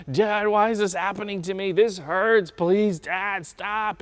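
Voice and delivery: hammed-up wailing plea to Dad; mock crying child. Preacher mimics his son during tooth pulling, tearful wail. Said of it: "he's still crying".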